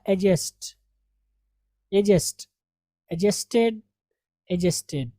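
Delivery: एडजस्ट as "a-jest", the d left out; silent d. Shown as a demonstration. Teacher drilling corrected forms for students, silent d. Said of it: "डी silent है".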